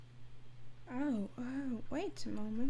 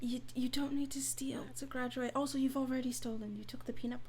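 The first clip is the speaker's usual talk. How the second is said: whispering; 3 semitones higher, 8 dB quieter than usual. Acted. Whispered aside.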